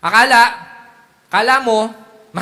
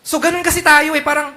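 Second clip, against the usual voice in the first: ordinary talk, nothing marked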